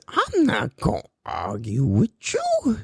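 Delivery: slow, drawn-out catchphrase impression. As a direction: stretch every word in a put-on voice. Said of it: "What does [name] say?".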